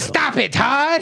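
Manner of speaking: high shrill mom scold. Retold or imitated.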